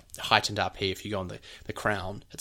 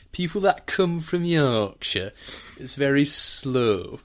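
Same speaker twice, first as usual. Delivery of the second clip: slow, higher, louder Yorkshire lilt; drawn-out, emphatic Yorkshire accent; 5 semitones higher, 6 dB louder, slower than usual. Shown as a demonstration. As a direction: put on a very slow, reassuring Yorkshire voice. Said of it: "people from the north tended to feel".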